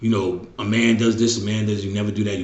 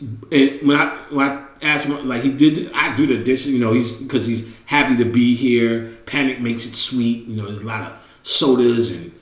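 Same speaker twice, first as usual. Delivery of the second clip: usual speaking voice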